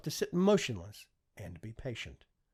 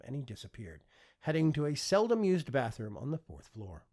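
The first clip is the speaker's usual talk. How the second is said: ordinary delivery, nothing marked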